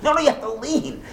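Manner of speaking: high, fussy no-no-no scolding; a lightly hammed-up scolding relative; mock nagging parent. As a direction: play the bossy Seder elder stopping the kid.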